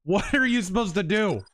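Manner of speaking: ordinary delivery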